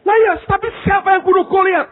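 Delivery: high, loud angry pastor quiz; stern pastor. Retold or imitated. Pastor grilling a frightened student, angry and loud. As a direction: bark the question like an irritated pastor.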